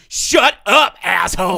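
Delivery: high, loud hammed-up yell at the driver; mock furious shout. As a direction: bark it like a rude woman losing it. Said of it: "She's like".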